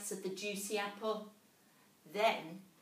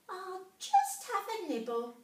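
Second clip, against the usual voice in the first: a tiny mouse gasping in wonder; little mouse voice; 11 semitones higher, 6 dB louder than usual. A narrator's reading.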